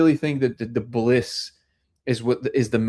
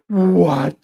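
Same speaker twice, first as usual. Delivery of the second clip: loud, higher astonished "what"; imitating wide-open amazement; amazed disbelief; 5 semitones higher, 5 dB louder than usual. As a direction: act out stunned wonder in one burst. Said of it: "like, this little kid".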